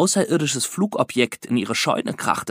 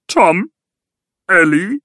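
alien voice from inside; 6 semitones higher, 4 dB louder, slower than usual. A narrator's reading. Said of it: "drang eine vertraute Stimme aus dem Inneren".

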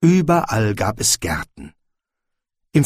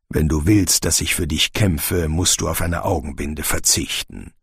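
low, measured soldier's voice; firm general; 6 semitones lower than usual. A narrator's reading. General refuses the blindfold, low and firm. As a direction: set the condition in a lower voice.

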